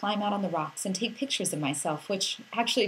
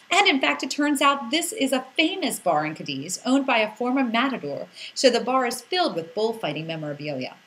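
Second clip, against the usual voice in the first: ordinary talk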